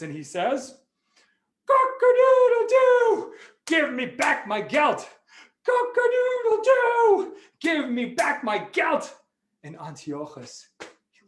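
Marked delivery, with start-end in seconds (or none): 1.7-9.2 high, loud crow with long drawn-out vowels; crowing rooster. Acted. Rooster to King Antiochus demanding its gelt, crowing loudly.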